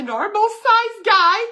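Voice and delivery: high, loud, slow squeak; mock frightened reader. Reading the scared viewer's worry in a squeaky mock voice. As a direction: play the panicked letter writer. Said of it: "mock frightened letter".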